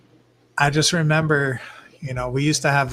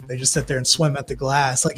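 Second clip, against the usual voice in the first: ordinary talk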